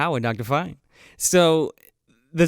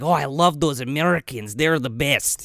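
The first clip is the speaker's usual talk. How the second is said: put-on Claude's accent; 6 semitones lower than usual. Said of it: "he talks like this".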